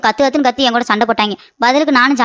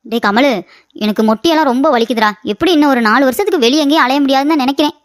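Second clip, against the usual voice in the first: ordinary talk, nothing marked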